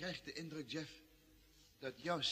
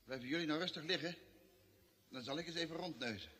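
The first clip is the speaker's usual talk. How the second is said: ordinary talk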